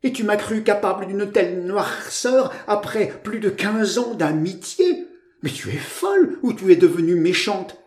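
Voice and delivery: high, wounded protest; moaning. A narrator's reading. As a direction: lament your friend's suspicion. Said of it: "gémissait Georgette".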